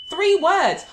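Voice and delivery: loud, high punch on both words; excited, loud emphasis; emphatic. Real, not acted. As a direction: drive home how little it takes. Stressing how short the answer is.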